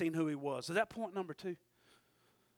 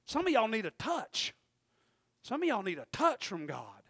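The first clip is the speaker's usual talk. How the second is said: ordinary talk, nothing marked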